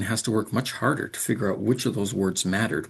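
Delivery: usual speaking voice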